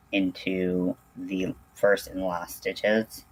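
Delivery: normal talking voice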